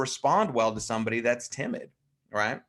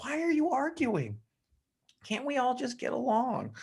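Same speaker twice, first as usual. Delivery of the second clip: very high, plaintive put-on voice; pleading peacemaker; 13 semitones higher than usual. Yellows begging the others to stop arguing, pleading. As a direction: imitate a distressed peacemaker, high and small.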